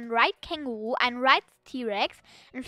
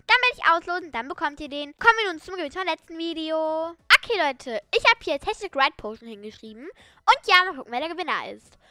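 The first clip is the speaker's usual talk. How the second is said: hyped giveaway announcement; 4 semitones higher, 3 dB louder than usual. Acted.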